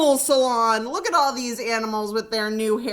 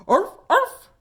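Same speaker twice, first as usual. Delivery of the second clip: much higher, louder yapping; small dog yap; 9 semitones higher, 5 dB louder than usual. Acted. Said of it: "added Tiny".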